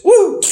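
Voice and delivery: short high-pitched "woo"; mock whoop. Recapping a wrestler's flashy move, mock whoop.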